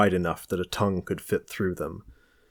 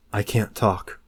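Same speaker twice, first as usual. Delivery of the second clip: ordinary delivery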